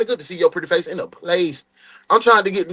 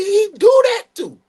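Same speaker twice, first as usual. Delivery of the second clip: high, loud hype shout of praise; 5 dB louder than usual. Real, not acted. Hyping his friend's talents to chat, loud high shout. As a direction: shout it up to hype your brother.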